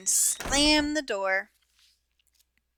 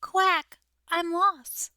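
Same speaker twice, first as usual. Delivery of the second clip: small sad voice; 7 semitones higher, slower than usual. A narrator's reading. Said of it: "a small and sad voice".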